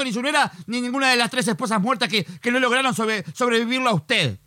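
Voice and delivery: high-pitched, fast exasperated rant; exasperated scolding. Acted. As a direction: scold him, losing all patience. Operator loses patience, scolds caller.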